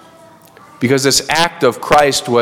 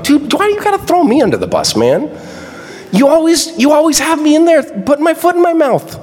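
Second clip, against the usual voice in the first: high, fast whiny complaint; griping Peter; 14 semitones higher than usual. Retold or imitated. Peter complaining to John about the gospel. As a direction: gripe at John like a wronged buddy. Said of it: "a little bit of a gripe".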